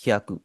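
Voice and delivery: ह्याकु with the final ku clipped short. Shown as a demonstration. Teacher models ह्याकु for students, final u clipped short. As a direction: say ह्याकु as two quick syllables, hya-ku. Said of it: "ऊ की आवाज ... कम आपने करनी है".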